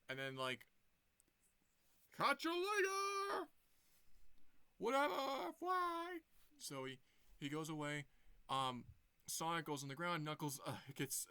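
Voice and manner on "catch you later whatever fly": very high, loud, drawn-out retreat cry; fleeing villain shout. Acted. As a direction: yell it while escaping. Villain flees in retold scene.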